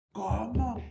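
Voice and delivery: high, loud disbelieving cry; aunt crying out in shock at the news. Retold or imitated. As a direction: gasp it out in disbelief. Aunt hears of the death.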